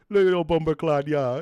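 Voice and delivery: high, loud Jamaican slang; Jamaican accent. Retold or imitated. Jamaican dudes in the van talking slang, Jamaican accent. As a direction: exclaim it like a Jamaican cabbie. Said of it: "just Jamaican dudes".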